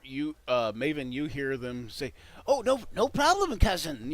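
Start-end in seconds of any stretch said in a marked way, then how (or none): 2.5-4.1 high, loud eager goblin voice; fawning, frightened guard quick to agree; nervous goblin. Acted. Scared goblin answers the bugbear.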